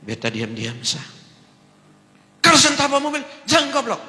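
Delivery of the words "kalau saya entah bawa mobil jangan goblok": very high, loud furious yell; shouting. Retold or imitated. Drunk man screams at the pastor, high and loud.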